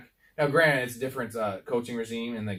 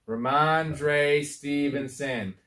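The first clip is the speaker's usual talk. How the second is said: Rhamondre Stevenson drawn out, every syllable bounced; slow syllable by syllable; 2 semitones higher, much slower than usual. Shown as a demonstration. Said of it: "Can we all say it together?".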